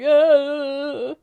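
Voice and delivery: high, loud choked cry; incoherent wail. Retold or imitated. Answering a knock on the stall door with a choked cry. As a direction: blurt a high, wordless sob. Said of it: "I said something incoherent like".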